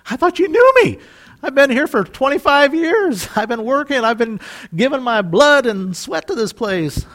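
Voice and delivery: high-pitched wounded outrage; acted, incredulous complaint; indignant protest. Rejected worker protests to boss.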